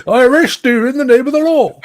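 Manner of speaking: high, hammed-up Irish accent, laughing through it; joking Irish accent with a laugh in it. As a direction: ham up a stage Irish accent, giggling. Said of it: "I can do the Irish accent if you'd like me to".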